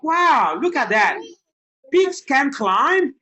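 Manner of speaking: high loud slow wow; slow, loud, high mock astonishment; mock amazement. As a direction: ham up the surprise for the child.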